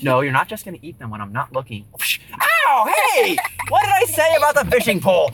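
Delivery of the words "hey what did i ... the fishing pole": normal talking voice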